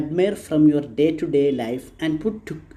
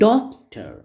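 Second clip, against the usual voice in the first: "Doctor" as DOC-tor, split in two with the second falling; "Doctor" in two beats, weight on the first; first syllable stressed. Shown as a demonstration. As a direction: punch DOC in "Doctor", pause, then tor. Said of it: "first syllable has been stressed".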